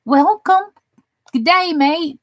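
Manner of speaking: mock Australian welcome, tossed off lightly; Australian accent. Greeting an Australian viewer in a playful Australian accent. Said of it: "I am Australian".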